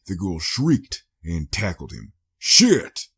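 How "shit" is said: high, loud sudden curse; sharp swear. Acted. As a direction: spit the curse out as he's tackled. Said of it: "he swore".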